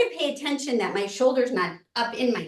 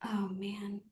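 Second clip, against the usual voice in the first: whispered sigh of relief; whispering; 3 semitones lower, 9 dB quieter than usual. Real, not acted. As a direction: breathe it out in a whisper. Relieved sigh during neck roll.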